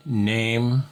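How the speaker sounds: slow, spaced-out call example; deliberate demo pace; slow example phrase. Shown as a demonstration. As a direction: spell out the on-air call slowly, word by word. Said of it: "If you said".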